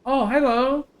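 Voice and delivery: high sweet greeting to an animal. Real, not acted. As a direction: coo at the goat.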